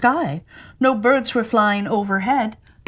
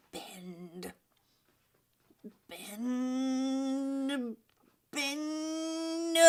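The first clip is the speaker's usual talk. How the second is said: straining whisper; 5 semitones higher, 15 dB quieter, much slower than usual. Acted. Straining to bend spoon.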